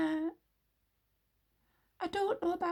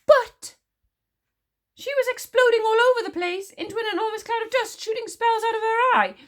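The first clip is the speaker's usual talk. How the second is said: much higher and louder, fast frantic babble; babbling; 6 dB louder than usual. A narrator's reading. Witch smeller frantically reenacting the explosion, babbling. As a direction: babble it out, arms waving. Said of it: "babbled the witch smeller".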